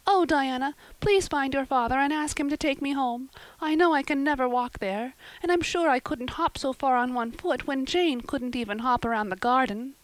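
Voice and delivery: put-on high girlish voice, pained; a hurt little girl asking for help; young Anne. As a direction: Anne begs Diana to fetch her father. Anne's voice, high.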